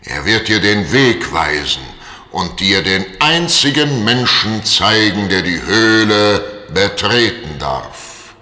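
slow, booming spirit voice; thundering voice. Acted. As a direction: boom it out like a mighty genie. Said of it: "rief mit donnernder Stimme".

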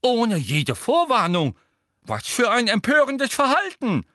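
put-on scandalised old examiner's cry; professor crying out. A narrator's reading. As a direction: exclaim in shocked outrage. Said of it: "rief Professor Tofty".

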